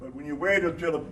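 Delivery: ordinary talk, nothing marked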